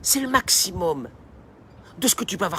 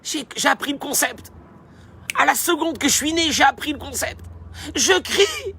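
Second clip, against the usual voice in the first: high-pitched infant lecturer; little know-it-all baby voice; baby explaining; 7 semitones higher than usual. Acted. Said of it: "Et puis il dit".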